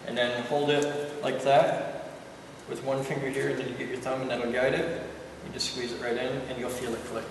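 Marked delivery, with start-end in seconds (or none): none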